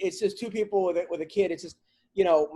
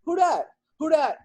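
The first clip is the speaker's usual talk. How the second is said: put-on high toddler voice asking over and over; imitating his toddler; 9 semitones higher, 4 dB louder than usual. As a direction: mimic a little kid pestering with questions, pitched high. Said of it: "because she goes".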